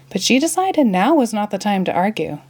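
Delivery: ordinary delivery, nothing marked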